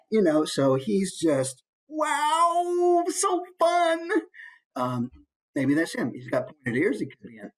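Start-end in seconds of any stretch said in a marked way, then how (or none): 1.9-4.1 put-on very high childlike voice full of wonder; drawn-out high-pitched delight; so excited. Shown as a demonstration. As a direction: marvel like a thrilled little kid. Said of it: "maybe he's just so excited".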